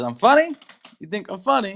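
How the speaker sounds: a hint of a touchy gangster; Joe Pesci. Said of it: "he says".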